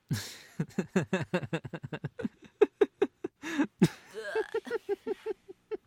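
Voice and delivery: long hearty laughing; prolonged amused laugh; loud laugh. Acted. Laughing at own insult.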